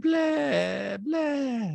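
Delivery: high, drawn-out blahs; Diana. Retold or imitated. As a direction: intone it eerily, dragged out. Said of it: "that's what even Diana says in a talking head".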